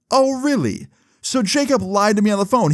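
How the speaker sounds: high-pitched surprised reaction; surprised, pitch leaping high; retold surprise. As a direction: pitch it way up, taken aback. Reacts to learning of betrayal.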